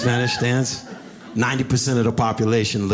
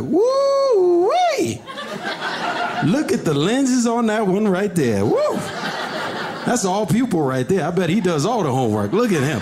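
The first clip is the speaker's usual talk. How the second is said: catcalling bullies; 11 semitones higher than usual. Retold or imitated. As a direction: holler like a crew whistling at a girl. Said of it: "they'd be like".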